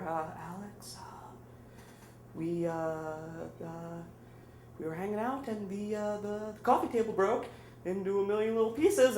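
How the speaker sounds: very soft, halting mock-timid confession. Storyteller confesses to Alex by payphone, exaggeratedly timid. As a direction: hesitate and shrink, barely daring to speak. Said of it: "in the most timid voice ever".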